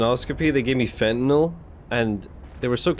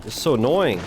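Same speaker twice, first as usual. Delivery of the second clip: normal talking voice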